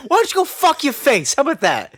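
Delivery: high laughing taunt; drunk taunt. Acted. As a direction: jeer at the stepdad through a laugh. Drunk Mikey insults Dale.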